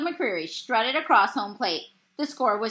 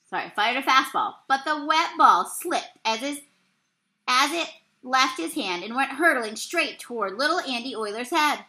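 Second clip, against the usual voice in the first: usual speaking voice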